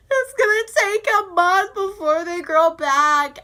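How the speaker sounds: loud, high hammed-up lament; mock distressed wail. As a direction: cry out the complaint in exaggerated dismay. Retelling her complaint to mom.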